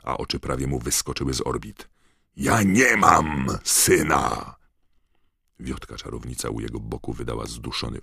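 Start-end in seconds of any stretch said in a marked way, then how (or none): 2.4-4.6 very slow, drawn-out loud bellow; thundering rage; furious roar. A narrator's reading. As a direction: bellow each word as a final disowning. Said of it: "ryknął pan Crouch".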